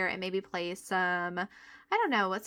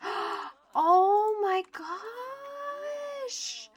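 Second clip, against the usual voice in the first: gasp of wonder; 11 semitones higher, much slower than usual. Real, not acted. Sees pretty necklace, gasps.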